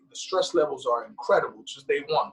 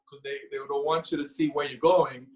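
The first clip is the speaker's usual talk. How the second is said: ordinary delivery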